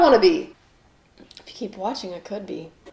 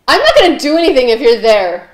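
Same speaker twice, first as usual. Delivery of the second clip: raised voice at an intruder; loud telling-off; 10 dB louder than usual. Real, not acted. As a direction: call out loudly in protest.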